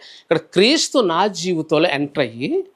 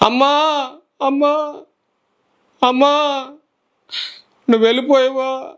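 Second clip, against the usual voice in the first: high, tearful cries of amma; retold weeping, calling for a mother who is gone; crying out for mother; 7 semitones higher than usual. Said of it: "అని ఏడుతున్నాను". (ఏడుస్తూ అమ్మను పిలవడం)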